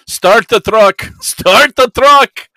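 loud, high in-character yell. Acted. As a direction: holler it urgently at a sleeping driver. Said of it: "he starts yelling".

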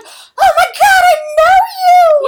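high, loud squeal of recognition; excited recognition. Retold or imitated. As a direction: shriek it like spotting an old friend. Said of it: "And so Kersey's like".

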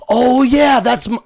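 loud, high retold outburst; sudden realization. Storyteller catching on mid-argument with a drunk, loud mock realization. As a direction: burst out as the penny drops, hammed-up.

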